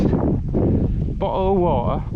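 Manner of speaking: exaggerated American accent, very slow; mock American accent. Shown as a demonstration. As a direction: stretch "Bottle of water" out like an American. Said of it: "We don't pronounce our T's".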